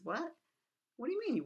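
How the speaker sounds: mildly incredulous retelling of a startled king; taken aback. Acted. Acts Oedipus's disbelief at the refusal.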